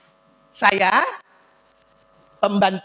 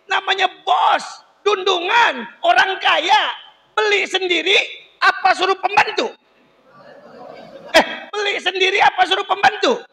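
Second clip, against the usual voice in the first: calling out; 8 semitones higher than usual. Real, not acted. Preacher quizzing the audience loudly.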